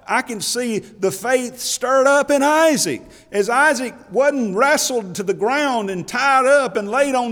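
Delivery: high, loud, slow hollering sermon climb; preaching at full force. Acted. Preacher to congregation on Abraham's faith, full-force high loud preaching. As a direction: lift the whole church with it, stretching every word.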